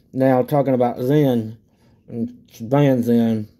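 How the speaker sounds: talking around a chew; pausing to spit; tobacco chew in mouth. Real, not acted. Seen 2: Spits into a dark-filled bottle before and after; chewing lips during words.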